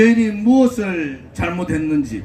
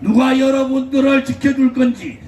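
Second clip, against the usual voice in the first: rally speech; 6 semitones higher than usual. Acted. Fiery rally speech to crowd.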